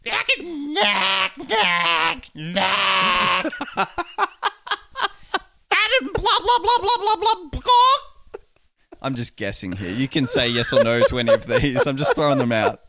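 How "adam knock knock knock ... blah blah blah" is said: very high nagging voice; high blah blah nagging, laughing; girlfriend impression. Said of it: "your impression of her".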